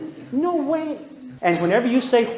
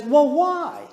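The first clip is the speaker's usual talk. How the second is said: put-on kid's why; 7 semitones higher than usual. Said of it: "And he says".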